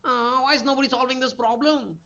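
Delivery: high, mock whining complaint; whiny complainer. Speaker mocks complainers to the audience, high whiny voice. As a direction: whine it like a grumbling cribber. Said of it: "they sound like".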